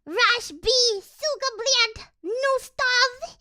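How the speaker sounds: loud, high Russian gamer shouting orders; mock Russian gamer. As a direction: yell it like a hammed-up Russian teammate. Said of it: "they're always like".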